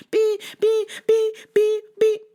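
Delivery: high honking beep beep; car horn beeps. Retold or imitated.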